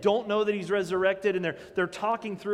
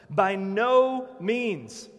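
emphatic slow denial; 5 dB louder, much slower than usual. Acted. Emphatic reading of Paul.

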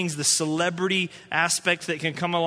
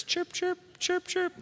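high-pitched, softer cricket chirping; cricket chirps; 11 semitones higher, 5 dB quieter, slower than usual. Retold or imitated. Preacher showing the silence that met him, cricket chirps. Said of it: "It's like crickets".

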